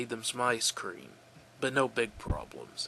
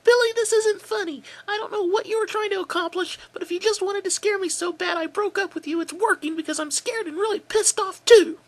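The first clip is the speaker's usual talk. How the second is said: much higher, louder, scared and furious; high angry girl's voice; 8 dB louder than usual. Acted. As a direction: yell at the ex down the phone. Angry scared girl on phone.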